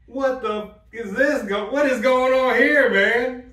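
high, loud and slow, exasperated; loud exasperated outburst. Retold or imitated. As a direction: shout it out in disbelief. Retold inner outburst in pain.

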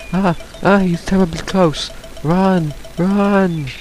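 high frantic cries; scared horror game streamer. Real, not acted. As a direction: yelp while fleeing. Fleeing the shell.

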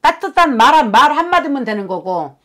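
high, loud pleading lament. Acted. Channelled sister-in-law telling the family her grief, loud and high. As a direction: pour out the dead woman's hurt at full voice. Shaman voices the dead woman's lament.

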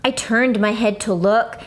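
usual speaking voice

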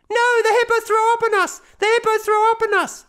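put-on high, loud little boy protesting; child voice. A narrator's reading. Boy protesting innocence to dad, high loud child voice. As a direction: insist like a small boy caught out.

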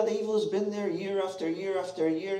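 ordinary delivery

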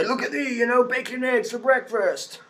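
high, smug mock voice of a bacon lover; mocking paleo fan. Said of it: "and go".

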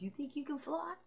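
high, teasing question with a rising end; a high mock question that lifts at the end; mocking taunt. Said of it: "she goes".

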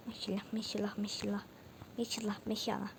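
soft, whispered repeated charm; whispered blessing. Real, not acted. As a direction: whisper it under your breath, repeatedly. Murmured charm while crossing carefully. (fısıltıyla maşallah çekerek)

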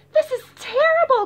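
high, loud wail; high loud despairing cry; cry of dismay. Acted.

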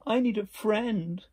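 high, loud, slow plea; drawn-out storybook call for company; lonely little beaver. A narrator's reading. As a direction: plead across the pond in a little animal voice. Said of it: "he said".

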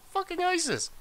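a comic high squeal on "isis"; squeaky mock voice. Repeats it in a squeaky voice.

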